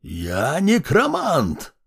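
slow, high shriek; screeching. Acted. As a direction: shriek it in outrage. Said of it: "Он перешёл на виск".

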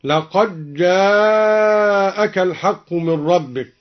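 لقد جاءك with the d assimilated, laqaj-jaak, slowly; إدغام الدال في الجيم; dal merged into jim. Shown as a demonstration. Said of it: "وأدغم الدال في الجيم".

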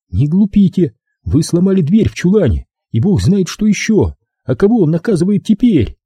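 high-pitched rebuke; high, exasperated challenge; scolding captive woman. A narrator's reading.

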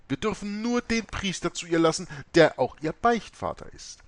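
high, loud, firm refusal of an abbess; mother superior refusing a priest, high and firm. A narrator's reading. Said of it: "lehnte Mutter Oberste entschieden ab".

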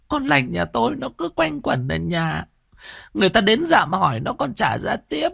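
alarmed protest. A narrator's reading. Mother protests her daughter's innocence to villagers, high and alarmed. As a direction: defend your daughter in alarm. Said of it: "kinh hãi phân trần".

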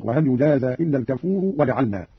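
الكفور ending in -u, run into وجعلنا; joined nominative ending. Shown as a demonstration. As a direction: sound the damma on الكفور and carry straight on. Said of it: "وإذا وصل برفع الكفور". (الكفور بالرفع عند الوصل)

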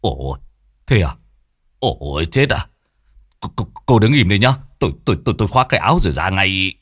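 shocked and hurried. Acted. Long shocked, hurrying out.

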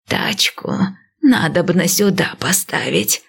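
breathed out, near whisper; breathy exhale. Acted. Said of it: "выдохнула старушка".

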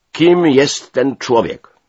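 loud, high, slow demand; menacing voice. A narrator's reading. Major demands who the stranger is, menacing voice. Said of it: "groźny głos".

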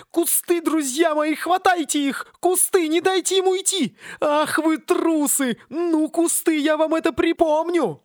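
high, slow frenzied tree voice; raging palm tree. Acted. Palm raging over its stolen coconuts, high and frenzied. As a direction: rave over your beloved coconuts. Palm calls bushes, curses.

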